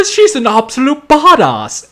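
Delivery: high-pitched mock British accent. Imitating the trailer's slip into a British accent. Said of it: "she has this British accent".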